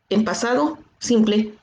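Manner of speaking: normal talking voice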